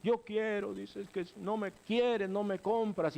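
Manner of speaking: put-on guilt-trip the parent like a sulking child; mock child sobbing in the store; whining child. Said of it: "empiezan a llorar y a llorar".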